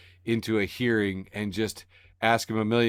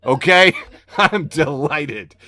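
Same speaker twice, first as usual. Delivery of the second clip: mock delighted; 2 semitones higher, 6 dB louder, slower than usual. Real, not acted. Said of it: "This is how bad things are".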